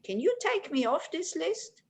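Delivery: a hint of a small animal asking a favour; bunny rabbit. Bunny asking the bear for a favour, lightly voiced bunny rabbit. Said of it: "then the bunny says".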